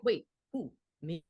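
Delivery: halting mock bewilderment; small, hesitant who-me reaction; baffled imitation. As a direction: act out confused disbelief, in tiny bursts. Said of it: "she looked at me like".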